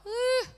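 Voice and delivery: high, loud emotional ooh. Real, not acted. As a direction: let the feeling burst out high. Moved by Narnia scene.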